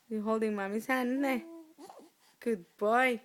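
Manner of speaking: low, soft motherly praise; tender soothing. Real, not acted. Mother coaxing baby to hold her hand, tender soothing.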